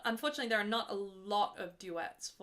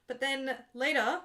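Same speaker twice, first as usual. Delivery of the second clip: ordinary talk, nothing marked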